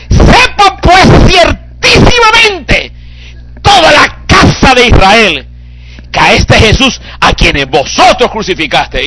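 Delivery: high, loud, slow crying proclamation; fervent preaching. Acted. Preacher voicing Peter to the house of Israel, loud crying preach.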